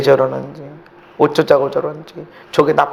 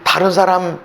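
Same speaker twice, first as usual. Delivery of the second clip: ordinary talk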